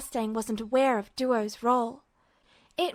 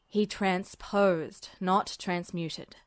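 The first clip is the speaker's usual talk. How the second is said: lower, slow, deliberate correction; 5 semitones lower, 4 dB quieter, much slower than usual. Acted. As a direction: spell out the difference calmly. Voices Mustang.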